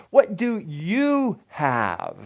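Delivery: slow, emphatic "you"; drawn-out words with the weight on "you"; stress on you. Shown as a demonstration. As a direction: stretch out the question and punch "you". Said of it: "not somebody else".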